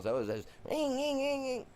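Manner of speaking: high, steady "ring, ring, ring" like a revving engine; a high held engine whine on "ring"; engine rev imitation. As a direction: mimic the engine whining at high revs.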